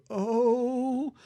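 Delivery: put-on slow, halting old man; a doddering elder groping for his line. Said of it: "old guy who can barely deliver his lines".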